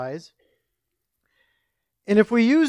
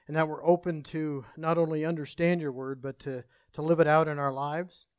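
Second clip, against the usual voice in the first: soft, low, subdued prayer voice; gentle spoken prayer, trailing softer toward the amen; congregational prayer; 4 semitones lower, 5 dB quieter than usual. Real, not acted. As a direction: pray quietly and humbly, with small searching pauses.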